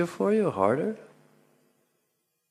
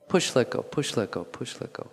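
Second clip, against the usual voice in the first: quick beats of "push, let go", fading to a whisper; rhythmic cue fading; 6 dB quieter than usual. Shown as a demonstration. Teacher drilling the movement rhythm, fading from spoken to whispered. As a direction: count the rhythm, then let it die away. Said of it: "Try it a little quicker".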